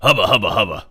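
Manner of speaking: loud, leering wolf-whistle voice; lecherous robot Santa; leering hubba hubba. Retold or imitated. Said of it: "he went".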